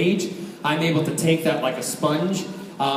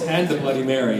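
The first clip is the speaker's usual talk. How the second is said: normal talking voice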